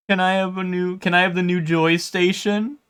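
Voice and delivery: lightly voiced whiny child plea; pleading kid. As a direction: beg dad for a new console like a needy kid. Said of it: "hit the pleading".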